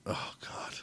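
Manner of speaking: unvoiced disgusted whisper. Real, not acted. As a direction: breathe it out in dismay. Dreading Long John Silver's.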